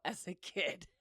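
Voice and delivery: ordinary talk, nothing marked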